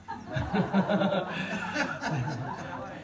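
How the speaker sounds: high excited burst of laughter; nervous giggle. Real, not acted. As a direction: laugh out loud in giddy awe. Nervous laugh meeting a hero.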